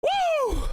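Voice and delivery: very high, falling woo; jubilant whoop. Real, not acted. Commentator recovering after the goal, high whoop. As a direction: whoop to let off steam.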